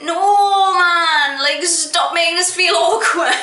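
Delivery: loud, high, drawn-out pleading; mock outburst at unwanted small talk; exasperated protest. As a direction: plead loudly for it to stop.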